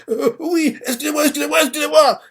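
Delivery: put-on a kid begging to be let off; scared apology. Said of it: "il commence à avoir peur".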